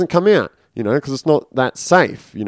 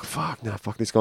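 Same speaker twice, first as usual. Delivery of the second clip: muttered curse; 12 dB quieter than usual. Retold or imitated. Muttered refusal.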